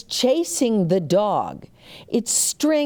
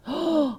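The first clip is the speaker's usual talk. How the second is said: sharp gasp; 7 semitones higher than usual. Acted. Storm suddenly coming.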